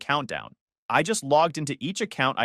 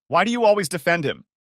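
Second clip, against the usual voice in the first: loud, high-pitched sulky protest; huffing; 6 semitones higher, 5 dB louder than usual. A narrator's reading. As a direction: huff it like a sulking child.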